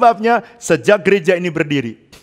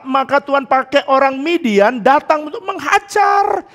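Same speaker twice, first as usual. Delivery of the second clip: usual speaking voice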